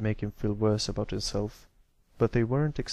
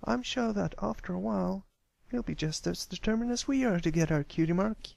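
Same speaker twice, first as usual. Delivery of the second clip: higher pitched earnest filly; a confident little pony reassuring friends; young filly voice; 9 semitones higher than usual. A narrator's reading. Narrator voices filly Apple Bloom.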